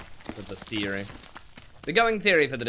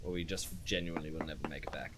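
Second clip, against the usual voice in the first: whisper; 8 semitones lower, 10 dB quieter, faster than usual. Real, not acted. Worried aside about supplies.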